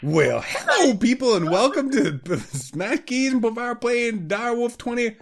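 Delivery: hammed-up host opening; show intro. Episode intro greeting.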